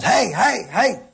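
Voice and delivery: repeated high-pitched calls to a herd; mock shepherd's yell. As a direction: play a shepherd just calling out sounds. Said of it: "just yelling out sounds".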